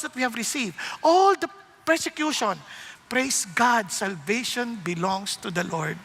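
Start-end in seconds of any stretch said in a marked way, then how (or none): none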